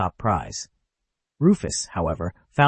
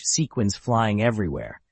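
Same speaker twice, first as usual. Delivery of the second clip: ordinary delivery, nothing marked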